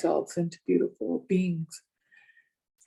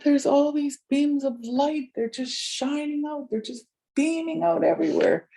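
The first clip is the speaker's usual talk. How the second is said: high-pitched, marvelling retelling; retold wonder; 9 semitones higher than usual. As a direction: act out someone amazed by the sight, pitched up. Retold awe at beams of light.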